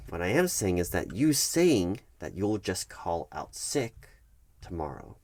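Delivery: usual speaking voice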